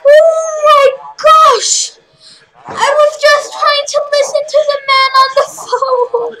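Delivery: loud, high, panicked cry; panicked shout. Real, not acted. Gamer screams after being jump scared. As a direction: shriek it out in real fright.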